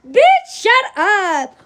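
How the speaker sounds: slow, high sassy put-down; mock sassy snap. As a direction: drag it out like a snippy friend. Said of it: "Bitch could be like".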